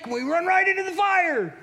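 high, loud panicked yell; panicked shout. Acted. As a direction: cry out as if running into fire. Running into the fire.

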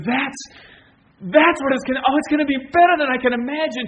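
high, loud, rushing exclamation; excited. Retold or imitated. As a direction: burst out with thrilled anticipation. Voices the heart's excited cry.

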